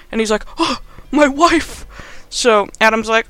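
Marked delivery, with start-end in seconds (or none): 0.6-1.9 high, slow, hammed-up shock; gasp, then wail it in fake horror; mock horrified gasp. Gordon hears his family is hostage, mock horror.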